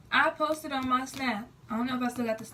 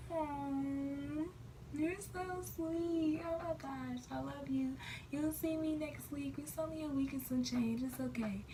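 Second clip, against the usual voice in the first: higher and softer, drawn-out coo; sweet cooing; 4 semitones higher, 8 dB quieter, slower than usual. Retold or imitated. Girlfriend cooing softly back to her boyfriend. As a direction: melt over something sweet he said.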